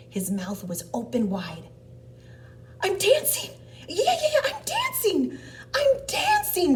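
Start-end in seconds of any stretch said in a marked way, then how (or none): 2.8-6.8 very high, loud, jubilant yell; elated cry. Acted. Gerald discovers he can dance, crying out elated. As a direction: cry out in overjoyed triumph. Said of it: "Gerald cried".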